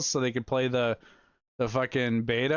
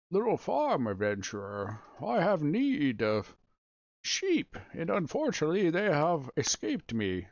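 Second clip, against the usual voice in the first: slow, old-timey cartoon farmer; vintage cartoon voice; 3 semitones higher than usual. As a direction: ham up a cartoon quest-giver. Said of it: "not in that cartoon voice".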